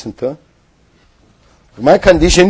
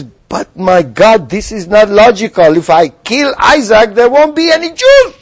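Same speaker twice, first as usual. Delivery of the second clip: doubting inner voice climbing much higher to a squeak; fretful reasoning voice climbing much higher; anxious voice of the brain; 5 semitones higher than usual. Retold or imitated. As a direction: let the brain's protest rise and rise into near-falsetto panic. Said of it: "And his brain says".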